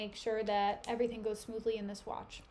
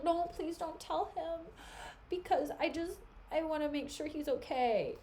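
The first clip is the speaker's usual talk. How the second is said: higher, softer mock plea; fake pleading; 4 semitones higher, 5 dB quieter than usual. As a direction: beg him not to tell, sweetly. Iago pleading falsely.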